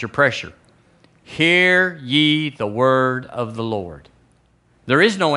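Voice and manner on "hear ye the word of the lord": slow, drawn-out herald's call; solemn proclamation. Acted. Preacher proclaims Elisha's words to the church, solemn and drawn out. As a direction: stretch each word like a public decree. Repeats scripture as remedy for trouble.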